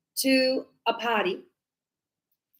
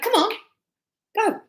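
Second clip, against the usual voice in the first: high, pushy order; impatient command; 10 semitones higher than usual. Shown as a demonstration. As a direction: urge them to go now. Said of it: "It's like".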